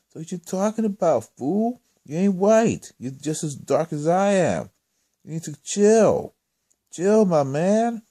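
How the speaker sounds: mock-confrontational scolding; indignant retort. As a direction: tell him off, stretching the last words. Acted retort in a quarrel.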